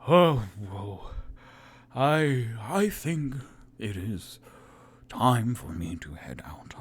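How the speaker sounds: drowsy half-whispered farewell; nodding-off wizard heading to bed; sleepy wizard. Acted. Sleepy Volo waking up.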